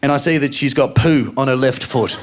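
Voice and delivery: normal talking voice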